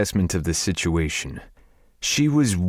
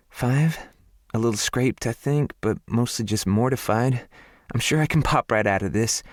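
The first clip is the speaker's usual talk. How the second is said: higher, sheepish and mortified; lightly voiced southern woman, embarrassed; 6 semitones higher than usual. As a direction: laugh it off, embarrassed. Her embarrassed answer.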